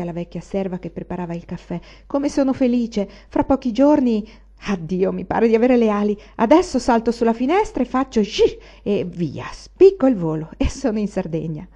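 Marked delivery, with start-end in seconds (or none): 2.1-11.7 bright and raised, bursting with happiness; a young man who can hardly contain his joy at leaving; loud, eager, giddy delight; joyful excitement. Acted. Anania's joyful outburst to Varvara.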